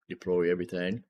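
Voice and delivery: ordinary delivery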